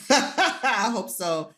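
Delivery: slow, laughing through the words. Real, not acted. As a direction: laugh it out, touched and shy. Laughing reply to praise.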